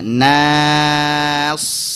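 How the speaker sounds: "Nasi" with a long held thin "Na" before thick "si"; lengthened na. Shown as a demonstration. Teacher showing practice method, "Nasi" with long Na. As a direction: stretch the Na of "Nasi", then thicken S. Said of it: "panjangkan dulu nanya".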